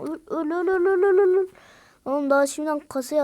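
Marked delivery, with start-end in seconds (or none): 0.0-1.5 rising, louder repeated cries; aggressive yelling. Real, not acted. Gamer yelling at enemy to die. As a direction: hammer it out building.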